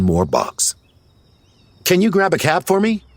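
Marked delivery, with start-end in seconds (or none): 1.8-3.0 high, quick call to someone nearby. A narrator's reading. Patrick calls to his assistant, calling out. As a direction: call it out quickly, pitched well up. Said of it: "he called out to the assistant".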